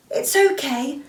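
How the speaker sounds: pleading sister voice; Anna pleading. Acted. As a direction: coax your sister eagerly. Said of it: "she said".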